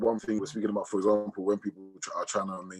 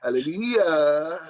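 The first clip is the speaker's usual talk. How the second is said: loud, higher, long drawn-out hallelujah; shouted hallelujah; 5 semitones higher, 7 dB louder, much slower than usual. Pretend. Answering a call to shout hallelujah, mock church shout. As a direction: belt it out slowly like a congregation's response. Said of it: "somebody shout hallelujah".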